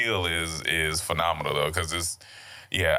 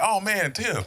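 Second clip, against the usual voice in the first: higher, eager greeting; excited greeting; 7 semitones higher, slower than usual. Retold or imitated. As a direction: greet him like you're thrilled. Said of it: "I'm super excited".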